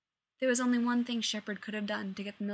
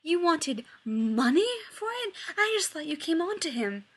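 shouting; 7 semitones higher, 6 dB louder than usual. A narrator's reading. Rodney yelling at Shepard in the brig, loud shout. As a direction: keep shouting in shocked disbelief. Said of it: "Rodney shouted".

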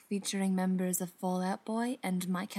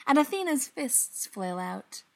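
plain reading voice

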